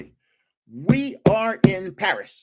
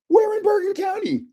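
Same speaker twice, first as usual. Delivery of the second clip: retold, pitched much higher with excitement; eager question. Pressing the young woman for her town, eagerly. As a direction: ask like you can't believe the luck. Meeting fellow Jersey tourists abroad.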